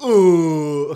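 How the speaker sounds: put-on high, loud kid's ooh; imitating a kid going ooh. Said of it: "it's got a kid say".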